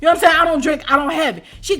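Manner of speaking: usual speaking voice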